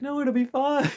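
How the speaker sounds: put-on high, carefree girl voice; breezy March. March dismisses the player's worry, high breezy voice. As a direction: toss it off lightly, way up high.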